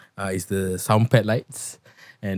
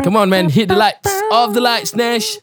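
calling out; 12 semitones higher, 4 dB louder than usual. Real, not acted. Calling out to turn lights off.

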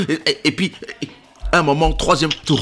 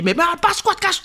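high mock quote. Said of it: "toi, tu dis".

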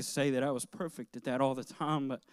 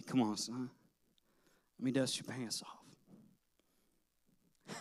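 softer, very slow, gentle fatherly reassurance; tender father; 3 semitones lower, 9 dB quieter, much slower than usual. Retold or imitated. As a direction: soothe the fallen boy quietly. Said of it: "No, he'd say".

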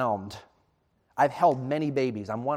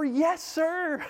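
eager yes; 13 semitones higher than usual. Retold or imitated. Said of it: "eager new father".

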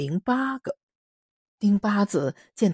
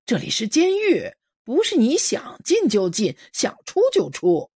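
提高嗓门厉声拦人; 像守门哨兵一样高声呵斥; 哨兵喝止; 5 semitones higher, 4 dB louder than usual. Acted. 哨兵拦住闯入者. (哨兵高声喝止)

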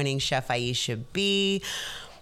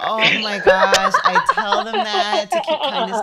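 laughing with delight; 13 semitones higher, 10 dB louder than usual. Real, not acted. Moved host laughs at shared saying.

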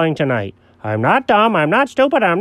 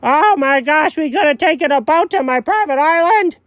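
ordinary talk

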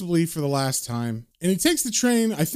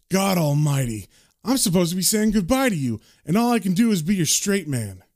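ordinary talk

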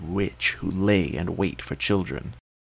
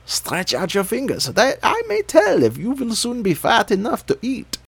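much higher, louder witch crying out; shrill witch call; 13 semitones higher, 4 dB louder than usual. A narrator's reading. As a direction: call out like a greedy old witch.

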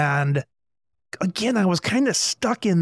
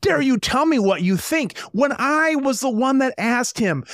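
retold outburst; 7 semitones higher than usual. Boss losing it at the delivery guy, mock indignant. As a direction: replay the blow-up as a raised-pitch scoff. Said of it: "And then I lost it on him".

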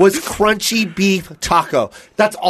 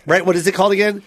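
ordinary talk

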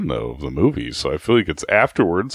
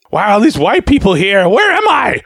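much higher, louder bewildered voice; a confused old woman in the foyer, hammed-up; mock confused old lady; 10 semitones higher, 5 dB louder than usual. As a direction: sound lost and amazed.

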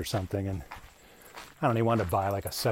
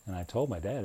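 ordinary delivery

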